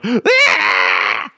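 loud sustained scream; mock scream. Brother screaming to mess with people, mock scream. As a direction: let out a prank scream. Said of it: "coming out screaming".

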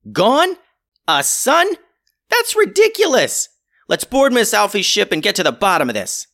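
high, loud disbelief; can't believe a sun is gone; incredulous. Acted. Incredulous captain.

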